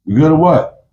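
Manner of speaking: high, pushy check-in; checking on him. Retold or imitated. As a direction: demand if he's good.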